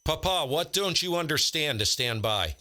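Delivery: ordinary talk, nothing marked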